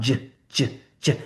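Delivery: voiced j with a buzz; j sound. Shown as a demonstration. Teacher showing voiced j again. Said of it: "Turn it on".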